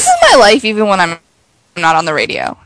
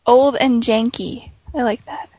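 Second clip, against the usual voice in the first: usual speaking voice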